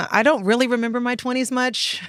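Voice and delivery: normal talking voice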